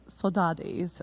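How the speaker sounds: "Saudades" in an American accent, ending in "days". Shown as a demonstration. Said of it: "say it with an American accent".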